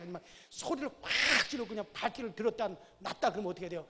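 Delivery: ordinary delivery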